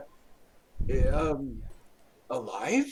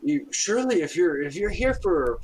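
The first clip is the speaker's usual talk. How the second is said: higher, louder eager host; eager priest; 8 semitones higher, 5 dB louder than usual. Acted. Priest offering help to the party, eager. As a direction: lean in helpfully.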